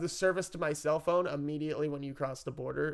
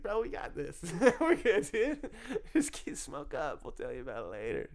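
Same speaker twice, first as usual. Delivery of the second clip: brain reassuring; 2 semitones higher than usual. Retold or imitated. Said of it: "but your brain's like".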